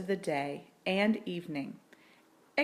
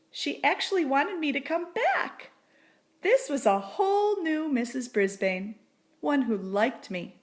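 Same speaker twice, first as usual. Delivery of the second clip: higher, louder astonished joy; amazed delight; 6 semitones higher, 4 dB louder than usual. A narrator's reading. Humphrey thrilled to spend Christmas with them. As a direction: marvel at the news. Hamster amazed by good news.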